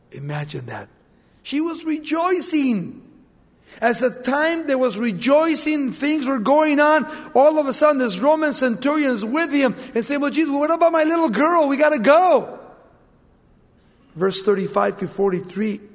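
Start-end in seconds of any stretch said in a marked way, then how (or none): 1.4-10.2 loud, high preacher's cry; rising, loud sermon voice; preaching at full force. Acted. 10.2-12.6 high, loud, fast urging; father pleading with Jesus to hurry; urgent plea. Acted.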